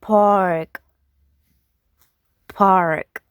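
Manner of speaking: "pork" as park with a full r; American park. Shown as a demonstration. Teacher contrasting accents, American r. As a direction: say "pork" as park, r sounded strongly. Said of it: "in America is".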